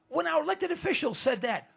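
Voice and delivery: ordinary talk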